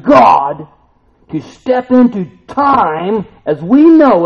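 loud, slow, soaring preaching; preaching at full force. Real, not acted. Pastor describing heaven stepping into time, soaring loud preaching. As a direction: build to a soaring climax.

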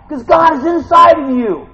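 preacher's rousing shout; fervent preaching shout. Real, not acted. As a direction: proclaim it loudly to the congregation. Preacher exhorting at full force.

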